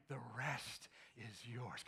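slow, low and sly; sneaky tempter voice. Shown as a demonstration. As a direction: lure them like a little sneaky person. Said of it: "Like a little sneaky person".

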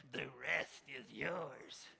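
slow, sly evil tempter; a villain tempting, slow; slow sinister hint of evil. Shown as a demonstration. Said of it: "You can put an evil voice to it".

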